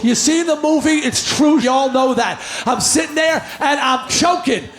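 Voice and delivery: ordinary talk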